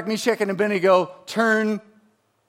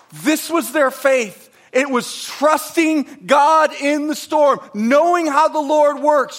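higher and louder, driving the point home; fiery preaching; 6 semitones higher, 4 dB louder than usual. Acted. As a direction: preach it with rising fire. Preaching builds to full force.

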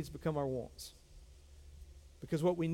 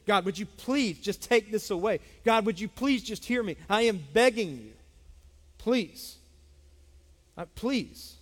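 louder, higher pleading prayer; anguished begging; 3 semitones higher, 4 dB louder than usual. Acted. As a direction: cry out to God, pleading.

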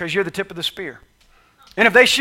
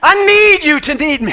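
loud, high, pleading need; God calling out to his people; booming voice of God; 9 semitones higher, 11 dB louder than usual. Acted. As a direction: cry it out as God's plea. Preacher voicing God, loud.

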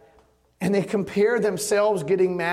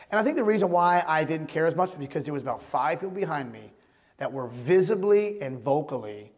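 ordinary talk, nothing marked

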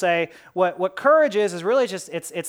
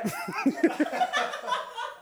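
real, quiet high-pitched laugh; helpless soft laughing at your own joke; 7 dB quieter than usual. Real, not acted. Preacher amused by the clown joke, laughing.

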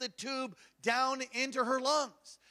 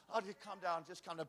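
self-calming mutter; 4 semitones lower, 11 dB quieter than usual. Retold or imitated. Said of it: "I started feeling like".

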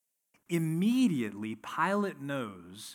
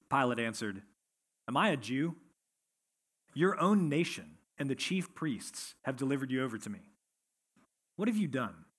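measured, lower Bible reading voice; scripture reading; 3 semitones lower, 3 dB quieter than usual. Acted. Pastor reading John to his church, measured and lower. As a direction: read the passage to the congregation, steady and low.

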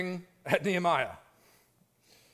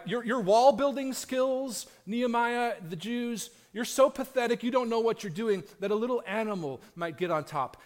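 higher, louder schoolyard taunt; mocking taunt; 5 semitones higher, 4 dB louder than usual. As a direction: sneer at Nehemiah like a bully. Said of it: "the gist of it".

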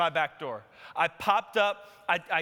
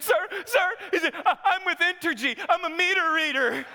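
higher, laughing nervous voice; a scared meter reader stammering out an explanation; nervous panicked pleading; 9 semitones higher than usual. Retold or imitated.

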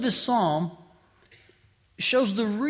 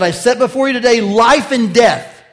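booming divine declaration; 5 semitones higher, 12 dB louder than usual. Acted. Quoting God's covenant words.

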